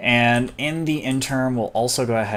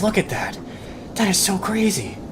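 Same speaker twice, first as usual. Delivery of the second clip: amazed; 10 semitones higher than usual. Real, not acted. Storm tearing up the trees.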